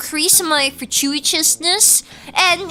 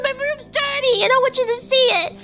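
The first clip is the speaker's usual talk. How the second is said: put-on pitched-up, fast childish plea; high whiny kid voice; 10 semitones higher than usual. A kid begging mom to stay out of her room, high whine.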